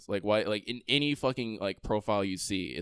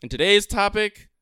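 ordinary talk, nothing marked

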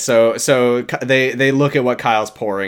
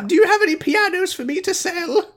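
very high put-on falsetto; silly pitched-up character voice; Carol. Asking for pianos to sell, high mock falsetto. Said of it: "And then Carol says".

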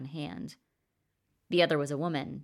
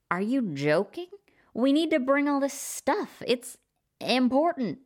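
higher, louder indignant protest; objecting; 10 semitones higher, 5 dB louder than usual. A narrator's reading. Blake objects to the blocked bridge, indignant and shrill. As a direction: push back hard, voice climbing. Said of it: "he objected".

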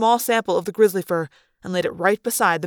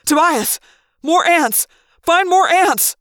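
high, loud, frantic orders; urgent yell; 8 semitones higher, 6 dB louder, slower than usual. A narrator's reading. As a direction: shout orders to a friend overhead. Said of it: "I yelled".

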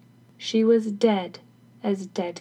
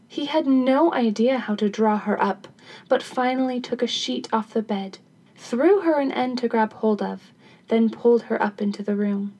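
ordinary delivery, nothing marked

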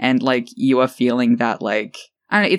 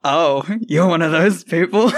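laughing, rising incredulous question; laughing disbelief; 4 semitones higher than usual. Retold or imitated. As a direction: laugh through a shocked question. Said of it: "I was like".

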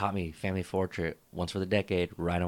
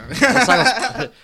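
laughing; 11 dB louder, slower than usual. Real, not acted. Laughing at the juice.

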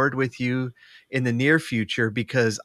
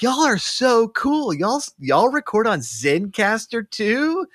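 high, drawn-out fanboy impression; teasing imitation of an impressed guest; mock gushing newcomer; 10 semitones higher, slower than usual. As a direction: gush it high and slow, in awe. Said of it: "That's my impression of them".

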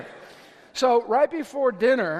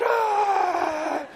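mock monster shriek; 8 semitones higher than usual. Body snatchers impression.

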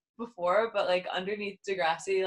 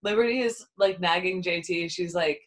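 ordinary talk

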